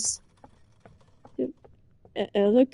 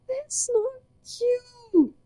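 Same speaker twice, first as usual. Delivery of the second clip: stretching the words out, baffled; incredulous protest; 11 semitones lower, slower than usual. Real, not acted. Protesting partner calls her cute.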